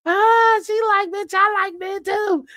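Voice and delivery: high-pitched, excited mock quote; playfully imitating a friend who's tickled; tickled friend imitation. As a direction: start on a loud high "Ah" and stay up high. Acting out tickled friend's reply.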